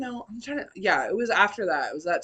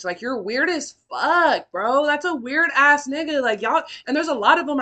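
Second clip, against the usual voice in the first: angry rant; 6 semitones higher, 7 dB louder than usual. Real, not acted. Ranting about cheating ex.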